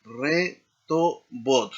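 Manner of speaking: "re to bot" slow, each syllable separate; slow teaching model of "re to bot". Shown as a demonstration. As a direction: spell out "re to bot" by sound. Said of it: "en el plural será".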